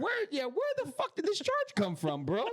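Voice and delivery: high, laughing disbelief; mock outrage. Seeing the surprise Uber charge, high laughing outrage. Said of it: "I was pissed".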